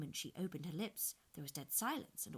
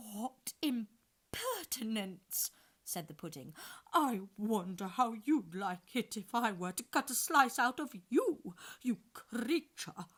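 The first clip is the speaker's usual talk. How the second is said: slow, loud indignant pudding; thick suety voice; 5 semitones higher, 10 dB louder, slower than usual. A narrator's reading. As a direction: huff like an offended pudding. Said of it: "in a thick, suety sort of voice".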